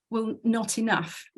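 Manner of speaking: ordinary talk, nothing marked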